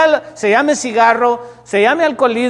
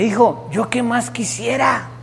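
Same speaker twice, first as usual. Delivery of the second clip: father's resigned confession. Retold or imitated. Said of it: "lo tira por la ventana y me dice".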